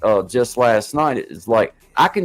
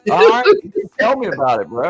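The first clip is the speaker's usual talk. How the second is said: hyped wrestler voice; 5 semitones higher, faster than usual. Pretend. Acted exchange with Randy.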